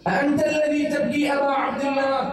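the repeated question rising into a wail. Retold or imitated. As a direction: lift أأنت الذي تبكي high as a lament. Zaynab's question repeated as lament.

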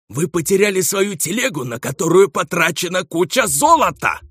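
high shouting dwarf voice; outraged dwarf. Acted. Angry dwarf elder protests.

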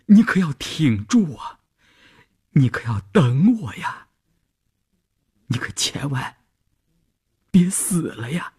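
high urging that sinks into a breathy hush; anxious inner plea. A narrator's reading. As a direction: plead with a dear friend to hold on. Silent plea to dying friend.

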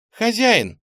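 высокий громкий возглас Люции; окликни хозяина высоко и громко. A narrator's reading. Строгая Люция окликает хозяина. (резко высокий, громкий оклик)

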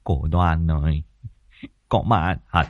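put-on subdued old man's voice, dazed with fear; weary, frightened husband's plea; shaken husband. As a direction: say it heavily, as a man still in shock. Shaken husband repeats the wife's plea.

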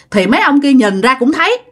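usual speaking voice

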